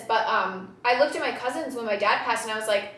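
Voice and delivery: usual speaking voice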